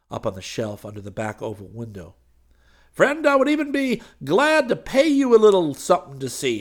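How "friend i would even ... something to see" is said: very high, loud, coaxing character voice; wheedling preacher. A narrator's reading. Preacher offering Hayes money for a look, wheedling. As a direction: coax eagerly, pitched way up.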